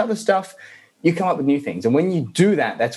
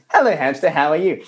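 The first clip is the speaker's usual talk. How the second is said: put-on slightly raised child-like chant; lightly voiced little boy; son playing with toy; 3 semitones higher than usual. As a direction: call it to the toy like a kid. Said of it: "he's outside going".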